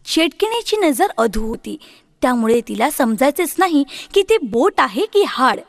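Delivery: usual speaking voice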